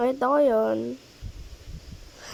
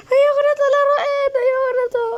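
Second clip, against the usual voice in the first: high crying whine, ayoko stretched; whimpering in fear; 11 semitones higher, 6 dB louder than usual. Real, not acted. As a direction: near tears, begging to stop. Panicking in horror game.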